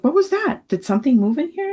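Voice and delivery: high, loud, hurried question, imitating surprise; startled questioning. Mother retelling daughter hearing mystery bells, startled. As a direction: act out a startled "what was that?".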